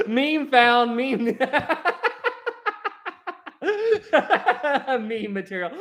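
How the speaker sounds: laughing between short phrases; real, high-pitched giggles; giggling fit. Real, not acted. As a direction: squeeze the words out between laughs. Still laughing at own failed attempt.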